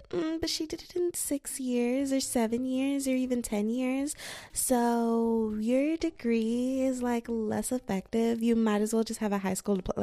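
high, drawn-out put-on sneer; mocking critic. Imitating critics of super seniors, mock condescension. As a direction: draw it out in high, mocking judgment. Mimics snobbish critics of slow graduates.

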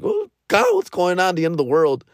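high-pitched mock panic of a child; panicked exclamation. Schoolkid crying to the others in the earthquake, mock panicked. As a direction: act out a kid's end-of-the-world fright.

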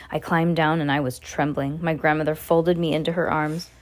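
plain reading voice

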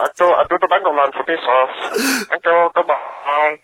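badly placed cartoon European accent; generic European villain accent. Caller signing off his voicemail, European villain accent. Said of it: "generic Hanna-Barbera European villain".

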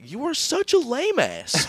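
high whiny mock Scotty; mock insult. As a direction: sneer it like a whiny teen. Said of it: "he's like".

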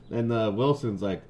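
plain reading voice

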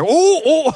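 high yelping ooh of pain. Pretend. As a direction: yelp as the jaws clamp down. Handler voiced in alligator's grip.

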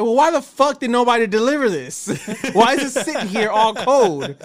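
loud, high mock rant at the staff; indignant retold outburst; retold angry outburst. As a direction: act out yelling at the lazy workers. Angry retold confrontation at counter.

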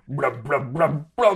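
car engine struggling to turn over; imitated engine cranking. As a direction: sputter like an old car refusing to start. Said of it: "you turn the crank".

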